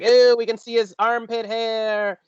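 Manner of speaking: put-on high, slow kid's ew; grossed-out kids. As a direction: mimic a disgusted child dragging it out.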